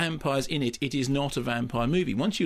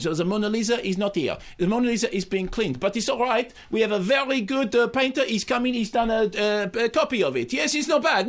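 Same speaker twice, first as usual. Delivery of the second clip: hammed-up French accent, pitched higher; mock French accent; 6 semitones higher than usual. Co-host doing a Louvre attendant in a mock French accent. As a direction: play a Louvre guide in an over-the-top French accent. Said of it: "this is in English with a French accent".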